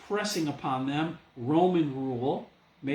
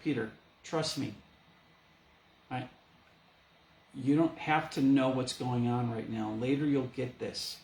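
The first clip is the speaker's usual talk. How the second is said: Jesus reassuring Peter; 5 semitones lower than usual. Retold or imitated.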